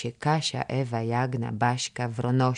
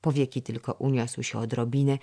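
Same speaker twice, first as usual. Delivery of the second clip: usual speaking voice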